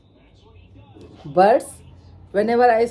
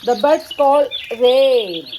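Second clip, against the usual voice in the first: ordinary talk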